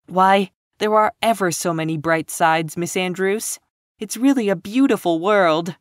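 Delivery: put-on high, eager girl's voice; eager cry. A narrator's reading. Anne insisting to Miss Andrews, eager protest. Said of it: "cried Anne".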